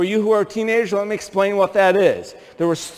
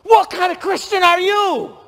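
scolding mom; 10 semitones higher than usual. Retold or imitated. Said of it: "Then she said this".

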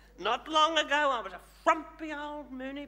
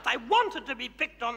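ordinary delivery

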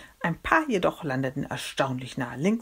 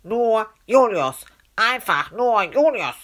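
high, loud nasal insistence; drawn-out, indignant nasal correction; nose held shut; 7 semitones higher, 7 dB louder, slower than usual. Acted. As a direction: insist slowly through a pinched nose. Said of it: "nahm dort seine Hand von der Nase".

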